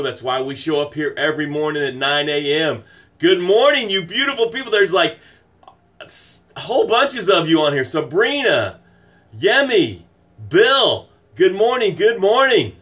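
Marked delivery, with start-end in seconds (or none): 3.2-12.7 high, loud cheerful hello to viewers; excited greeting. Real, not acted. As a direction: beam out a big good morning.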